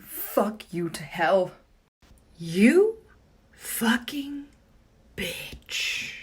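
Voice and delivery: slow, venomous whispered curse; angry whisper. Real, not acted. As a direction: hiss it at the computer. Cursing at iMovie.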